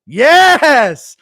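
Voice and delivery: long, loud high-pitched yes; excited cheer. Real, not acted. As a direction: cheer it at the top of your voice. Cheering a chat message.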